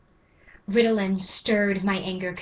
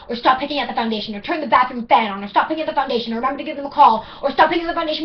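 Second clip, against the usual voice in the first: higher and louder, rushing refrain; frantic building list; 5 semitones higher, 9 dB louder than usual. Acted. Poet listing her failures to her ADHD, frantic. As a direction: pile the items on faster and faster.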